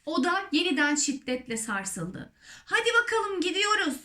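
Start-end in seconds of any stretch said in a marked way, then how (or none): 2.6-4.0 high, rallying call; excited cry. Acted. Creatures as the peach shakes, excited cry. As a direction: call out high that it's time.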